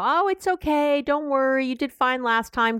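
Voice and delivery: high, breezy brush-off; mock soothing parent. Shown as a demonstration. Parent brushing off a teen's math test fear, breezy and high. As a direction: wave the worry away like a dismissive parent. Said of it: "don't go".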